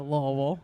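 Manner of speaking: "Louisville" said as "Luh-vul", low, swallowed; local pronunciation. Shown as a demonstration. Comic proving she says the city's name like locals. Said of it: "Don't say it like it's spelled".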